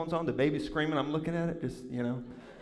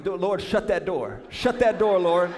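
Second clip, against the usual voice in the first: louder, insistent plea to the Lord; hammed-up urgent prayer; urgent praying; 2 semitones higher, 4 dB louder than usual. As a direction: act out a pleading prayer, building in volume. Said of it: "I've been praying".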